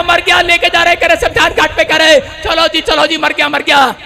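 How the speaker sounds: high-pitched revival storytelling; impassioned preaching. Acted. (जोश भरी ऊँची आवाज़ में उपदेश)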